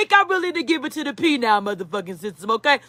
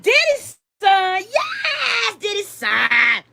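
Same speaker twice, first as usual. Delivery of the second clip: excited fans shouting; 5 semitones higher, slower than usual. Retold or imitated. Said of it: "They gonna be like".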